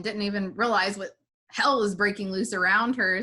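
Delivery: ordinary talk, nothing marked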